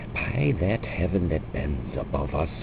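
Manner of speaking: ordinary delivery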